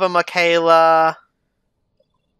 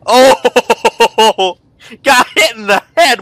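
laughing; 4 semitones higher, 7 dB louder, much slower than usual. Real, not acted. Entrant struck with a gun.

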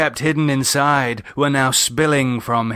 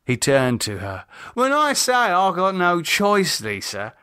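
lightly voiced man, resentful and weary; David, bitter; 6 semitones higher than usual. A narrator's reading. David explains his family.

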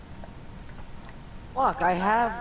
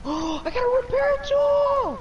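excited shout. Real, not acted. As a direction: yelp with sudden delight. Finds rare item in game.

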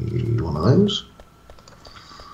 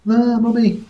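high squeaky voice; 5 dB louder than usual. Acted. Kid voice talking to character.

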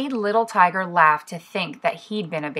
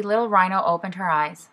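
ordinary delivery

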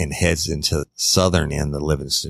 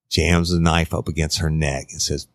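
usual speaking voice

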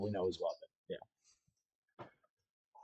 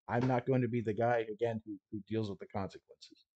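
a hint of a no-nonsense smuggler laying down rules; lightly voiced stalker guide, matter-of-fact; gruff guide Coyote; 2 semitones higher, 4 dB louder than usual. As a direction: tough guide briefing his clients, half-acted. GM plays Coyote briefing group.

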